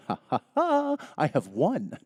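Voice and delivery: mock evil gloat over winning the argument; gloating laugh. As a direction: cackle like you've just won. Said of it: "thinking to myself".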